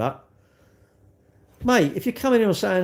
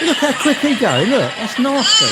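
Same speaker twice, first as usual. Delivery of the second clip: laughing in disbelief; 9 semitones higher, 7 dB louder than usual. Real, not acted. Laughing at runner overtaking him.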